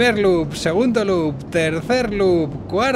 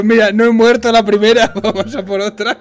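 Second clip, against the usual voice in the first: a lightly voiced louder rider, laughing; mock her cheerful request; voicing the rider; 3 semitones higher, 6 dB louder than usual. Voicing the rider wanting another go, mock. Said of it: "Ha dicho".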